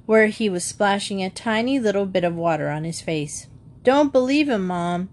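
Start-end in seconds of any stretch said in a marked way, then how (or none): 3.8-5.1 high-starting call to Mom from another room. Acted. Jan warns his mother from the bathroom, calling out. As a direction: call it across to the next room. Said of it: "Jan called from the bathroom".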